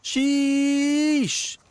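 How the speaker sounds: high, drawn-out delighted sheesh; wowed by the gift; impressed. Real, not acted. Receives in-game gift.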